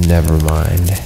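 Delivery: ordinary talk, nothing marked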